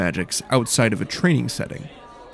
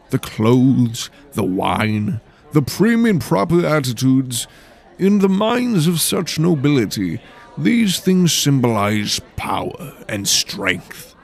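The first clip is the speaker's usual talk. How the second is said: posh nobleman lecturing; 2 semitones higher, 3 dB louder, slower than usual. Acted. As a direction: speak like a refined aristocrat. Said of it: "said in a posh voice".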